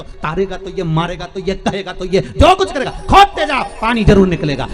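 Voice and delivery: rising to a loud, high preacher's cry on खोदते जाओ; fervent preaching. Acted. Preacher urging the devotees to keep digging, in a loud, high fervent cry. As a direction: preach it full force, building to a shout.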